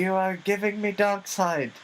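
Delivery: high, haughty diva voice; pampered star griping; diva complaint. Pretend. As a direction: sneer like a spoiled star. Playing diva.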